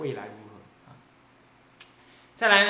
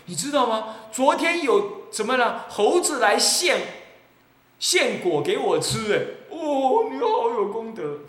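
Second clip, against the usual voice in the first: higher, louder, slow showing off; boastful bragger; 7 semitones higher, 6 dB louder than usual. Acted. Monk mocks a cultivator boasting monkeys bring him fruit, boastful. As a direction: announce the monkey's offering proudly.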